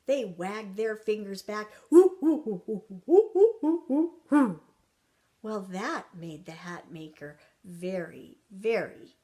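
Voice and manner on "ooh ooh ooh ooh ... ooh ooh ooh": loud, rapid imitated monkey calls; playful monkey "ooh-ooh"; monkey hoots. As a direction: hoot back like cheeky monkeys. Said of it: "And the monkeys".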